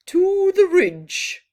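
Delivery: loud, high proclamation; booming proud announcement; proclaiming. A narrator's reading. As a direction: call it out proudly to the sky. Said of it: "he proclaimed".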